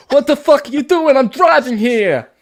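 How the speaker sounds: high, loud New York bark; mock New York yell. Brit friend joins in with a mock New Yorker yelling. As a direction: yell like a cabbie stuck in traffic.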